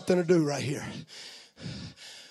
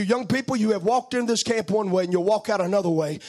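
ordinary talk

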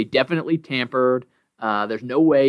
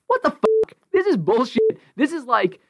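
real exasperated outburst, pitched way up; fired up; 12 semitones higher than usual. Real, not acted. [name] fumes at the Ringer tweet, high angry outburst. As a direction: blow up in disbelief. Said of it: "You're very fired up and emotional".

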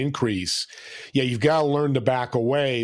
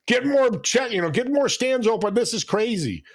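imitating a rude shopper, higher, half-yelled; mock angry customer; 9 semitones higher than usual. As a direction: play the impatient customer snapping at the checker. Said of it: "that one person yelling at the".

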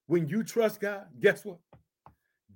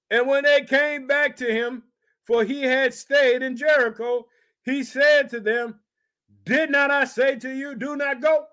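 raised, ringing preacher's reading of scripture; 4 semitones higher than usual. Acted. Preacher reads scripture to his congregation, raised and ringing. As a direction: proclaim the verse to the church.

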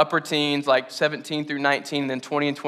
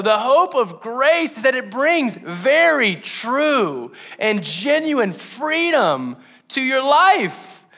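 higher, louder, impassioned preaching; preaching at full force; 9 semitones higher, 5 dB louder than usual. Acted. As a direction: drive the promise home to the church. Sermon climax.

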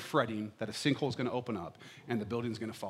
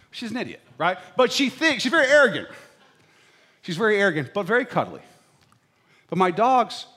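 usual speaking voice

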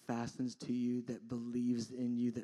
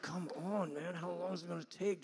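higher-pitched mock complaint; frustrated impatience; 6 semitones higher than usual. People waiting too long on God, mildly impatient. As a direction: act out being fed up with waiting. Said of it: "we just get frustrated".

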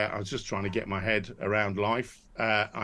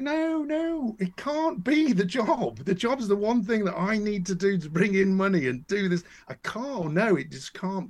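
recalling his own desperate denial in a raised pitch; retold protest; 10 semitones higher, 3 dB louder than usual. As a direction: insist it cannot be the job, voice up high. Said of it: "And I was like".

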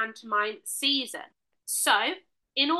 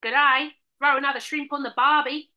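hammed-up Australian twang; broad mock-Australian greeting; Australian accent; 3 semitones higher, 4 dB louder than usual. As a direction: put on a stock Australian accent. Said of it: "That's what people from Australia say".

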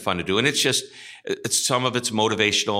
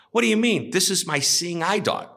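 indignant surprise; 10 semitones higher than usual. A narrator's reading. Second dog owner insists to the bartender, pitched high with indignation. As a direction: act shocked that anyone would doubt the dog. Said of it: "And he goes".

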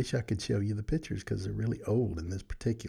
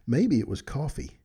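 usual speaking voice

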